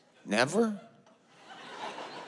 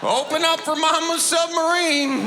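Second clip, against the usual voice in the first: mocking wife; 7 semitones higher than usual. Wife shooting husband, mocking him.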